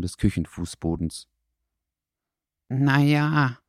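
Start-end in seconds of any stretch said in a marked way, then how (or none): 2.7-3.6 higher, hesitant dog voice. A narrator's reading. Dackel confesses to the fox, hesitant. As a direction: admit it reluctantly. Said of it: "gab Dackel nun zögernd zu".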